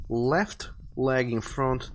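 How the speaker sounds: normal talking voice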